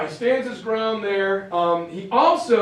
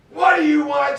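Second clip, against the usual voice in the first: angry shout; 8 semitones higher, 8 dB louder than usual. Retold or imitated. Angry Ike answering the knock.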